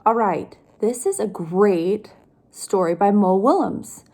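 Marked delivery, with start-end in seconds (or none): none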